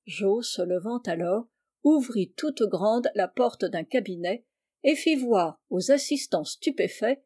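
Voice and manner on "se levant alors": plain reading voice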